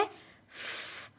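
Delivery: a voiceless "फ" with only air through the teeth; just the airy "फ" sound; breathy f. Shown as a demonstration. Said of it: "फा का जो sound है". (केवल हवा वाला "फ")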